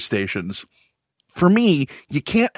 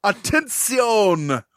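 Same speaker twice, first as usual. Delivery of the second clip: slow, drawn-out, higher broadcast call; numbers station monotone; 6 semitones higher, much slower than usual. Retold or imitated. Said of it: "Spanish word for attention".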